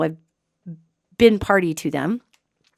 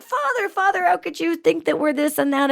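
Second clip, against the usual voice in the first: high, put-on pleading child; heart-pulling plea; 8 semitones higher than usual. Demon sons plead with their father, in a high mock plea. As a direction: imitate a son tugging at heartstrings. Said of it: "tried every heart puller".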